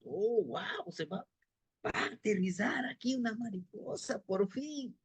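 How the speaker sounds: susurrando asombrado, sin voz; murmúralo asombrado, como quien no cree lo que ve; susurro sorprendido. Acted. (los gusanos del pueblo susurran asombrados al ver aterrizar la mariposa)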